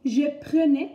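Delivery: "prenais" said "preu-nais"; E as schwa. Shown as a demonstration. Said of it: "on prononce le E".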